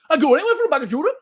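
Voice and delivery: high, loud, fast Geordie attempt; rough advert-style Geordie; bad Geordie accent. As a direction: rattle it off in a shaky Geordie accent. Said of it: "not very good at Geordie accent".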